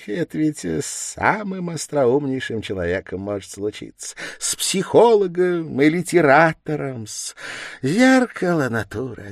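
sly, animated investigator with swooping pitch; wily teasing investigator. A narrator's reading. Investigator baiting his suspect with a lecture, wily and teasing. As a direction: stretch key words playfully, with little giggles. Plays Porfiry in monologue.

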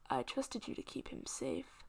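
soft, heavy with blame; quiet accusation from the Fey King. Acted. Fey King blaming Green, quiet and cold.

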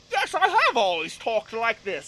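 put-on affected British accent, slow; put-on British accent, drawn out. Singer claims she was always British, British accent. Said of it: "[name] decided she was British".

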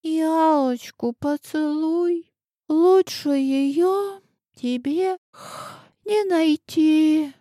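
сонно и медленно; тянет слова полусонно; сквозь сон. Acted. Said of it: "сквозь сон пробормотала она". (сквозь сон)

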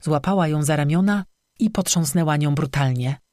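usual speaking voice

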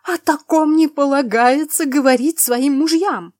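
shrieked, scandalized scolding; outraged lecture at full pitch; choking with indignation. A narrator's reading. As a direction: cry it out high and very loud. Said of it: "задыхалась от возмущения".

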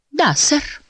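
small, high polite voice; polite piglet. A narrator's reading. Piglet answers the big Squire, polite. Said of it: "вежливо ответил Шпунтик".

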